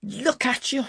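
high, rough scolding; rough angry voice. A narrator's reading. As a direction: snap it out roughly in anger. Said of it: "began in a rough, loud voice of anger".

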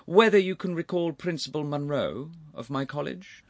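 casual, high-pitched inquiry; easy, conversational. A narrator's reading. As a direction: inquire as if making small talk. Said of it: "in an easy conversational fashion".

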